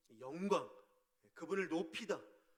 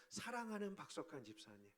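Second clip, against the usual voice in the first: tender address; 8 dB quieter than usual. Shown as a demonstration. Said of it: "이렇게 부르기로 했어요".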